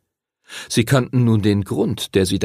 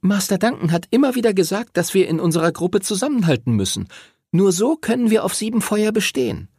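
put-on high, insistent girl's voice; girl urging; 10 semitones higher, faster than usual. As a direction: urge them to stick together, high. Voices Lucy urging teamwork.

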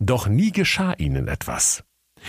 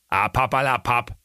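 put-on Berlin accent; 5 semitones higher than usual. A narrator's reading. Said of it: "rief da der Berliner Bär".

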